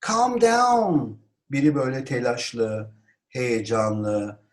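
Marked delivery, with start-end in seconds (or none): 0.1-1.2 high-pitched exclamation; raised voice. Shown as a demonstration. Teacher models calming an agitated person, exclaimed. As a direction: call out to settle someone. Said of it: "bunu yüksek sesle".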